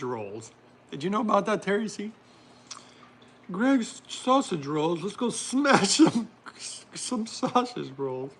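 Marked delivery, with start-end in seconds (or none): 3.5-8.3 slow, drawn-out mock-British; hammed-up British, nearly cracking up; attempted British accent. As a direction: put on a bad British accent and stretch it out. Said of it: "I can't do my very British accent".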